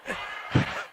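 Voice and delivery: a quiet breathy chuckle at his own joke; soft chuckle. Acted. God chuckles teasing the praying man, soft laugh.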